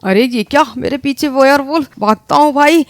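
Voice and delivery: usual speaking voice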